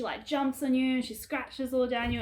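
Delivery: ordinary delivery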